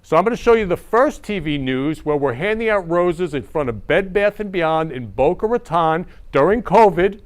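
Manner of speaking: ordinary talk, nothing marked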